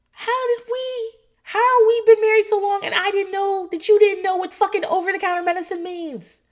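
very high, loud mock wife rant; hammed-up marital exasperation; exasperated outburst. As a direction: screech it at the husband in disbelief. Acted quarrel with her husband.

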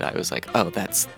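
ordinary delivery, nothing marked

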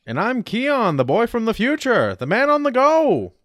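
high, slow showman intro; hammy announcer. Acted. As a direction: sell yourself like a game show host.

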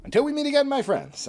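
put-on Southern gentleman accent. Sign-off in put-on voice.

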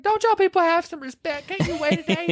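high, upset scolding; indignant. Retold or imitated. Retelling Evelyn scolding the staff, high and indignant. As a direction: scold them for having no respect.

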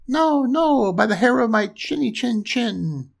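high-pitched piggy refusal; defiant little pig. A narrator's reading.

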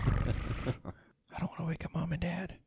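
slow, very soft whisper; playful secretive whisper; hushed. Pretend. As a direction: whisper like a kid sneaking around at night. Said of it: "We gotta be quiet".

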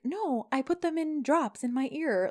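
high, slow mock-sweet comeback; acted sarcastic retort. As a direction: toss it back with drawn-out sarcasm. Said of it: "she's all sarcastic back to him".

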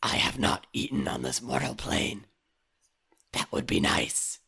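raven voice. Acted. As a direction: speak like an ancient bird spirit. S2 holds the Raven voice.

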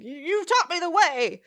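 high, slow, mock-heroic declaration; hero's big speech. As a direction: proclaim it like a hero addressing a pupil. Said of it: "gives a big speech to Izuku".

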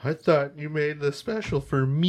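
mock hurt. Host parodying fans upset the art isn't exclusive, mock-hurt whine. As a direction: wail it softly like a let-down collector. Mock disappointed fan quote.

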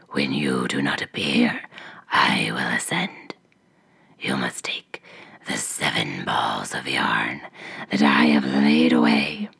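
hoarse whispering ogress; low rasping witch whisper. A narrator's reading. Ogress answers.

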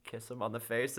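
ordinary delivery

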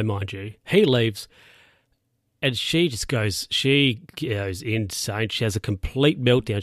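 normal talking voice

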